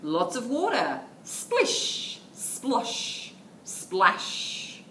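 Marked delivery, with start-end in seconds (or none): 1.2-4.8 whispered splish splosh splash; splashy sound words. Acted. Teacher reading Garden Stew to toddlers, whispered. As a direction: breathe the water sounds out.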